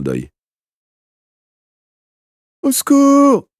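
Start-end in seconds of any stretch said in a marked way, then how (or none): 2.6-3.5 very high, loud desperate scream; boy pinned in the dentist's chair screaming for help. A narrator's reading. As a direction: scream it as they hold you down. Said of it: "parvint à hurler le patient".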